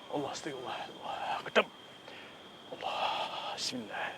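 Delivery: breathy, hushed prayer under the breath; whispered prayer. Real, not acted. Ghost hunter whispering a prayer as the spirit appears. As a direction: murmur the words to God, barely voiced.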